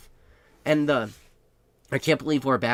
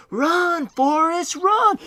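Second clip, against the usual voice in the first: shrill-pitched scream of run, loud and high; high screaming yell; 7 dB louder, much slower than usual. Retold or imitated. Girlfriend at the door screaming him on, high loud yell. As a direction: scream it from the doorway like Jenny. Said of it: "She's yelling".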